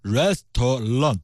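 restaurant said slowly in a mock Japanese accent, the r sounds turned into l; mock Japanese English on restaurant. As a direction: imitate a Japanese speaker saying restaurant, slow. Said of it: "日本人一说".

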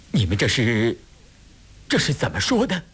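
taken aback, stumble over the start; astonished. A narrator's reading. Guest finds three strangers kneeling before him, astonished. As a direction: pitch jumping up in startled confusion. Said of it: "乌思道大吃一惊".

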